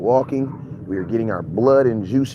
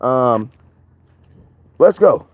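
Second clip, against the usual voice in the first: ordinary talk, nothing marked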